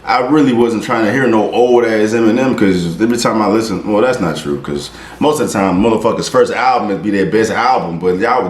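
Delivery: ordinary delivery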